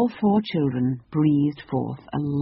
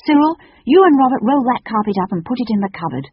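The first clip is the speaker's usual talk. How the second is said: put-on raised, higher girl's voice; brisk order; 7 semitones higher, 6 dB louder than usual. Sister ordering her brothers to hide the carpet, commanding. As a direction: call out instructions to the boys.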